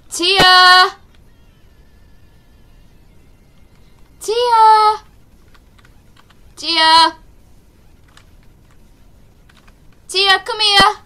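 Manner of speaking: loud shout across the house, high and sharp; calling the dog. Real, not acted. Gamer shouting for his dog Tia to come here, loud call. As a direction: yell the dog's name to fetch her back.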